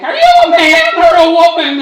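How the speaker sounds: high, loud mocking stranger; shouted stranger question. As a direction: yell it like a baffled passerby.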